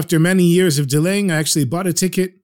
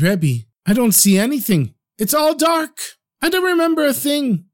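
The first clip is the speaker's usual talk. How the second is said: tearful; 10 semitones higher than usual. Acted. Dying water carrier weeping to the Rebbe, high cracking voice. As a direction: voice climbing high and cracking into tears. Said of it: "He starts to cry again".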